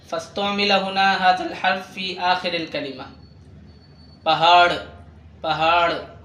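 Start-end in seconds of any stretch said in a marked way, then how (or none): 4.2-6.2 بهار ending on retroflex ڑ, "pahaar"; hard final r. Shown as a demonstration. Teacher models word-final hard r for learners, بهار with retroflex ending. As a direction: finish بهار with a hard flapped r. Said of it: "فالراء الثاني فيه الشدة نوعًا ما".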